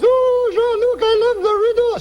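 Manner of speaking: squeaky high female voice; high woman voice. Retold or imitated. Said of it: "She's a woman, high voice".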